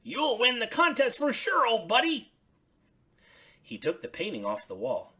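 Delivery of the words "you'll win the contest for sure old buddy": higher, louder friendly Bert. Acted. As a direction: voice Bert lifted up high. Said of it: "he said".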